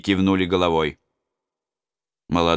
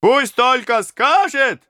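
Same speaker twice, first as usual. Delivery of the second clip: high, slow, loud shout; shouting robbers; 5 dB louder, slower than usual. Acted. Robbers to the prince, high slow shout. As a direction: cheer it back at him. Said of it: "закричали разбойники".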